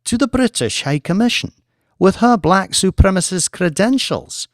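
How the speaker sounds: incredulous rhetorical question; lightly voiced diplomat, mocking the idea; scoffing official. Jean scoffs.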